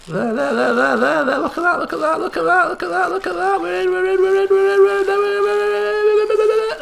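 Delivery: very high, rapid excited chanting; excited squeal. Real, not acted. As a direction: cheer the catch on, going way up. Fish finally hooked in game.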